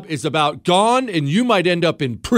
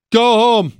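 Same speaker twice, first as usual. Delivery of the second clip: a mock official barking home orders, louder; bossy official order; 5 semitones higher, 4 dB louder than usual. Host mocking officials who ordered everyone home, bossy. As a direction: play the officious governor telling people to go home. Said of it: "they just started telling people".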